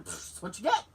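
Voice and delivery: dismissive puff, offhand verdict; imitated unsympathetic dad. Dad to crying granddaughter, mock no sympathy. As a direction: brush it off with a puff. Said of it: "With no sympathy at all".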